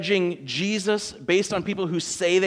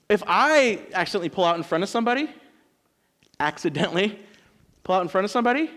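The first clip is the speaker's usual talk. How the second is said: normal talking voice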